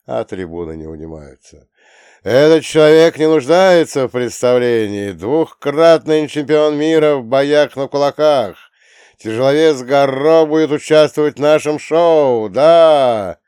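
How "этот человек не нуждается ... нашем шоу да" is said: arena announcer. Acted. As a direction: present the fighter to a roaring crowd. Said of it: "Голос ведущего".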